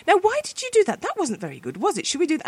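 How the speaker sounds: retold coaxing, as if reasoning with a pet; chatting to the dog. Owner reasons wordily with her dog, mildly scolding chattiness. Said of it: "I'd sort of talk to the dog and say".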